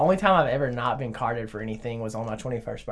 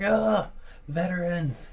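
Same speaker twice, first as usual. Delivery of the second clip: disgusted ugh; 5 semitones higher, slower than usual. Retold or imitated. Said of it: "Has anybody ever been like".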